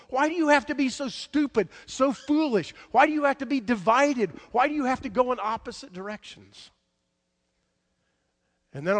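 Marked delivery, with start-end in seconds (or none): 0.1-6.7 high, loud, pleading reproach; exasperated rebuke. Acted. Elijah scolds the divided people, exasperated rebuke. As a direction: hammer out each question, pitched well up.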